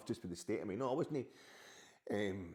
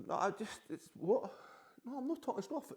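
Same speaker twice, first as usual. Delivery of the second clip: defensive reply; 14 semitones higher, 3 dB louder than usual. Real, not acted. Answering partner off-camera.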